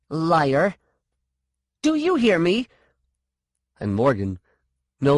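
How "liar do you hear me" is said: high, sharp accusation; raised, furious challenge; angry. A narrator's reading. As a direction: spit out the accusation, then demand a reply.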